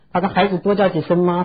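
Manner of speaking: normal talking voice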